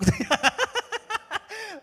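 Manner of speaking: high loud laugh breaking out; delighted open laugh; real burst of laughter. Real, not acted. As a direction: laugh hard at the memory. Laughs at own story.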